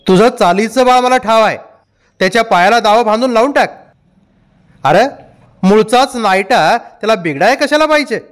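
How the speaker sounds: high-pitched, heated retort. A narrator's reading. Irritated father answering the complaint about his son, angry retort. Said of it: "इतक्या चढ्या आवाजात".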